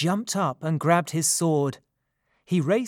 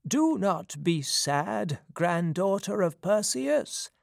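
put-on slow, higher-pitched old prophet; old man; 4 semitones higher than usual. A narrator's reading. Blind prophet consoling a worried mother, in an old man's voice. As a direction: answer as an aged seer consoling someone. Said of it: "answered the old man".

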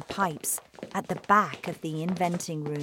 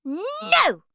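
put-on high, loud child's yell; piercing spoilt-girl protest; bratty shouted refusal; 7 dB louder than usual. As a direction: refuse it in a tantrum. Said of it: "shouted Veruca".

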